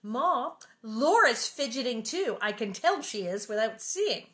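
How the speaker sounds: smug little tattletale; whiny tattling sister. Acted. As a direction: tell on your sister to Ma. Mary tattling to Ma in story.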